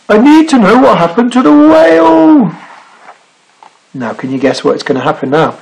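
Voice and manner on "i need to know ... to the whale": high, pleading shout with stretched ending; cried out. A narrator's reading. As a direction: plead across the water. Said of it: "he cried".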